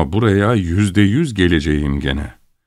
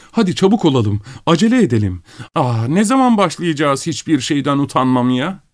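high-pitched hysterical woman, restrained; impatient cries; 8 semitones higher than usual. A narrator's reading. Woman among the dead urging haste, impatient and shrieky. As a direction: plead at a high pitch, itching to begin. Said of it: "sabırsız çığlıkları duyuluyordu".

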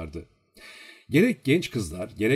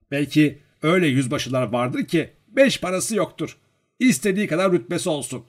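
high, loud, haughty bragging; boastful; 7 semitones higher, 5 dB louder than usual. A narrator's reading. Servant bragging to the girl about noble riches, puffed up. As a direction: boast of wealth and family. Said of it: "Vanyusha böbürlenerek söylüyordu bunları".

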